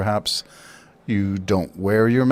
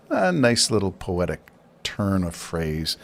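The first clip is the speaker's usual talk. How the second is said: ordinary delivery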